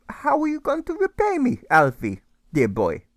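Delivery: put-on a jovial older mate teasing; German friend voice. A narrator's reading. The German friend teases Alfred about repaying him.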